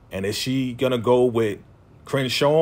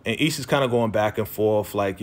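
ordinary talk, nothing marked

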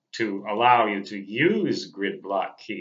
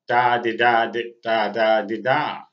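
spoken morse code; 5 dB louder than usual. Retold or imitated. Imitating morse code sounds.

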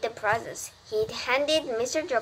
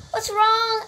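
high, loud worried call; startled announcer over the speakers; alarmed announcer; 11 semitones higher, 5 dB louder than usual. A narrator's reading. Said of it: "asked the loudspeaker".

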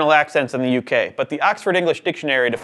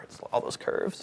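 slow, much softer whisper; whispered joke; 12 dB quieter than usual. Acted. Host jokes about the letter R's curves, whispering. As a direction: breathe it as a silly aside. Joking aside about writing R.

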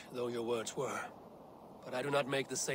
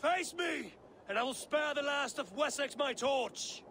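shouted challenge; 12 semitones higher, slower than usual. Acted. Eivor shouts a challenge at Alfred to face her. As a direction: call the king out to fight. Calling out the enemy king.

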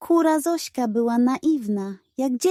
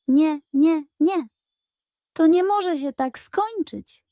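higher, insistent pug voice; determined pug; 6 semitones higher than usual. A narrator's reading. Pug vowing revenge, lightly voiced and insistent. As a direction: refuse it stubbornly.

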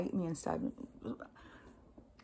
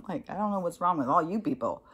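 ordinary delivery

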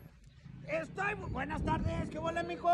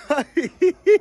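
laugh; 6 dB louder than usual. Real, not acted. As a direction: burst into a short giggle. Laughs at José crying.

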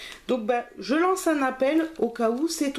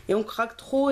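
ordinary talk, nothing marked

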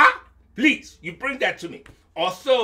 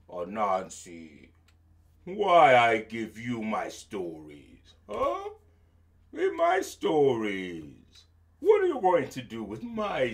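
king's voice; 6 semitones lower than usual. Acted. Sky King voiced, slow and low.

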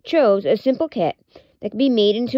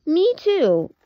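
high little lamb voice; high squeaky puppet voice; Lamb Chop voice; 8 semitones higher than usual. Acted. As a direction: pitch it up like a small puppet lamb.

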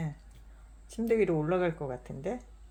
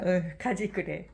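ordinary talk

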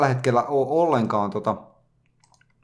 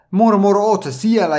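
higher, louder call into the void; mock calling out; 7 semitones higher, 5 dB louder than usual. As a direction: imitate a chatter calling out to him. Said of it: "Ja joku sanoo".

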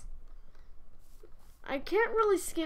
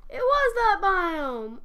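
excited discovery; 6 semitones higher, 9 dB louder, slower than usual. Real, not acted. Realizes the biome caused hunger.

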